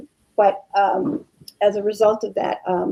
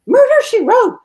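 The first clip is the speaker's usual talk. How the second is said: high, loud burst of surprise; astonished exclamation; 11 dB louder than usual. Real, not acted. Guest hears the forgotten show's name, real astonishment. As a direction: cry it out in amazed recognition.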